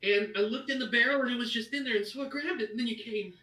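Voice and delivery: put-on high little boy voice, rushing; nervous child. The halfling boy confessing to Bertha where he found the necklace, high and nervous. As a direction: explain it quickly, afraid of blame.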